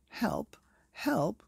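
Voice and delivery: high, loud panicked page; frantic cry for help. Acted. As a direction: shout it in alarm like a frightened page. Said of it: "cried the page".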